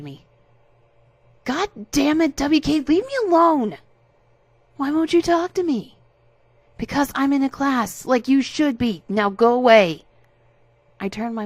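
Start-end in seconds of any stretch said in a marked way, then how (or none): 1.4-3.8 high, loud exasperated yell; angry shout. Acted. Narrator yells at harasser. 4.8-6.0 high, loud petulant whine; whiny demand. Acted. Harasser whines back. 6.8-10.1 angry scolding. Acted. Narrator scolds harasser.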